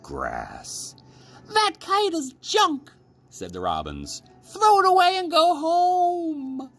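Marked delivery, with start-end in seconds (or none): 1.5-2.9 very high, squeaky mocking birds; high chirpy bird voices scoffing; jeering robins. A narrator's reading. 4.4-6.7 very high, squeaky mocking birds; high chirpy bird voices scoffing; jeering robins. A narrator's reading.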